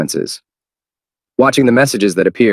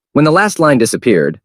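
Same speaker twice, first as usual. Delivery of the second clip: ordinary delivery, nothing marked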